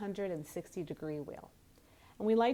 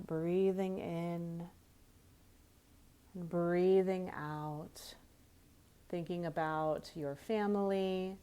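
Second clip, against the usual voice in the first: guided meditation. Real, not acted. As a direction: ease them gently through each breath, unhurried. Leads a centering breath meditation.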